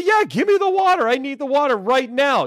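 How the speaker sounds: high, urgent begging for water; desperate plea. Pretend. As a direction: grab at the water like you're dying of thirst. Desperate desert crawler begging for water.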